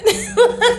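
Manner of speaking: high laugh at her own comeback; high burst of laughter. Real, not acted. Laughing at her own retort to the cousin, high burst of laughter. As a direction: let out a high amused laugh.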